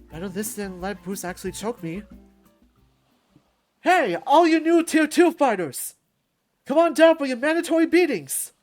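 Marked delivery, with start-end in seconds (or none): 3.8-8.5 rowdy champ's callout. Acted. Champ summoning new tier two fighters, rowdy shout. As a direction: holler like a wrestling showman.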